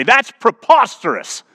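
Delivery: high, drawn-out indignant exclamation; slow, high mock indignation; mock outrage. Acted outraged reply to builder's price.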